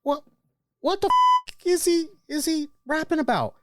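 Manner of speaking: mock shriek of disbelief, pitch jumping way up on the curse; wife's stunned disbelief. Wife watching [name]'s WrestleMania set, shrieking in disbelief. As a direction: squeal it like you can't believe your ears. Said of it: "and she's like".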